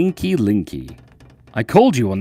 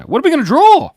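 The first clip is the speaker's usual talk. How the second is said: excited question; 9 dB louder, faster than usual. Real, not acted. Cheerful question to viewers.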